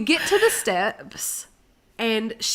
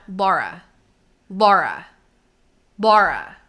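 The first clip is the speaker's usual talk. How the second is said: escalating calls; 4 semitones lower, 3 dB louder, slower than usual. Retold or imitated. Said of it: "escalate my voice".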